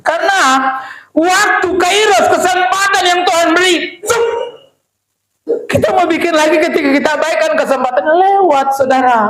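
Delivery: fiery sermon shout; preaching at full force. Acted. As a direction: rouse the congregation from the pulpit.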